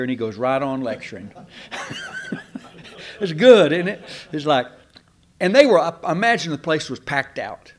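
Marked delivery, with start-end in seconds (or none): 1.4-2.6 a sudden, high, delighted laugh; preacher laughing at his own story's punchline; high burst of laughter. Real, not acted. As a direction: break into a bright laugh at the punchline.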